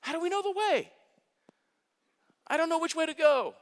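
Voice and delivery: lifted high, pleading voice of a lost disciple; anxious Thomas. Retold or imitated. Pastor voices Thomas asking Jesus the way, high and pleading. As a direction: play Thomas, high and bewildered. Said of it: "Thomas has this really incredible question".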